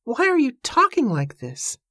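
high, imploring woman's voice; pleading. A narrator's reading. Evangeline begging Joshua to explain, pleading. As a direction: beg for an explanation in a lifted voice. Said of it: "she pleaded".

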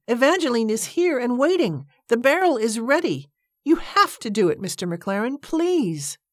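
high, imploring sales plea; wheedling. A narrator's reading. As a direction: plead dramatically, ending on a drawn please. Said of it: "You're wheedling".

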